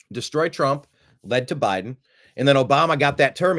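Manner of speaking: usual speaking voice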